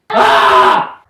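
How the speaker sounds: sudden breathy scream of alarm; startled scream. Real, not acted. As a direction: let out a sharp scream. Scream as something happens.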